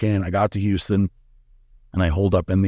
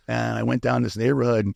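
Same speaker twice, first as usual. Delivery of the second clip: ordinary talk, nothing marked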